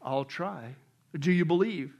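low, calm heavenly voice; voice from above. Acted. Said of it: "the voice from above".

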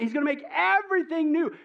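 ordinary talk

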